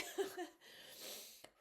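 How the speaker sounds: high breath of a laugh through tears; brief laugh while composing yourself; tearful little chuckle; small self-conscious laugh. Real, not acted.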